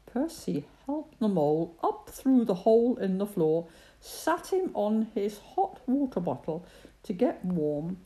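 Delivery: ordinary delivery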